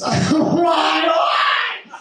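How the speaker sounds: hammed-up pitch crack; unstable mock outburst. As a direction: voice jumping high and shouting. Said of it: "emotion control medication".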